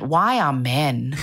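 low, drawn-out rhetorical question; retold exasperated remark. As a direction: say it low and slow, retelling your own reaction. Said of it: "And I just went".

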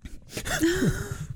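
short laugh. Real, not acted. Laughs at the urine remark.

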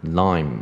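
"lime" ending on m with lips closed; M结尾发音. Shown as a demonstration. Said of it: "M的话". (念lime，结尾M闭嘴)

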